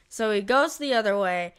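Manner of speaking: ordinary delivery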